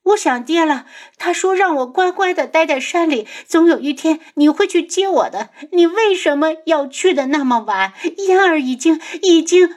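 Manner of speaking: put-on high, loud girlish wailing; heartbroken crying. A narrator's reading. 嫣儿 missing her father, acted breathless crying. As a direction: wail through tears, choking on the words. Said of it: "她哭得上气不接下气， 伤心至极".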